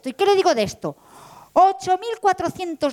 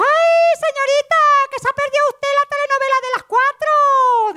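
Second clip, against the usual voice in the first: much higher, louder gossipy porter voice; excited porter; 12 semitones higher, 4 dB louder than usual. Acted. Porter calling out to the señorita, high and excited. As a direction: squeal it like a nosy porter.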